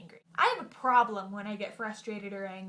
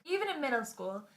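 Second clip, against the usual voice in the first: ordinary talk, nothing marked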